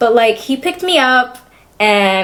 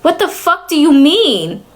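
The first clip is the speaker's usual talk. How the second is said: higher, drawn-out exasperated demand; indignant retort; 7 semitones higher than usual. Retold or imitated. Challenging her over the husband's name, indignant. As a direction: raise the pitch and stretch it in indignation.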